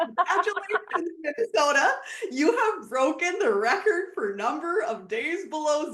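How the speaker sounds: playful imitation of a TV weather report; mock weatherman. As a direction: announce it like a cheery forecaster. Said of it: "The weatherman came on, said".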